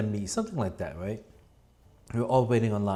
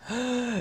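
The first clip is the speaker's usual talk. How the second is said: sharp gasp; 12 semitones higher than usual. Real, not acted. Sees phone test result.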